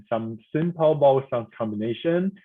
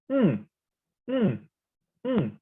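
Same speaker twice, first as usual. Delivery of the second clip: "mm" falling steeply from high; 6 semitones higher, slower than usual. Shown as a demonstration. Teacher reviewing the last one, falling tone. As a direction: let "mm" drop quickly from the top. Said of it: "falling tone".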